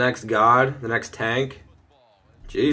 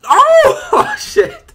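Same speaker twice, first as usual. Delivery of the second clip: very high, loud cry of alarm; startled yell; 11 dB louder than usual. Real, not acted. Gamer watching a pass go wrong, startled yell. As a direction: yelp it out as the play falls apart.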